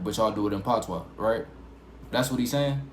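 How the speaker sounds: normal talking voice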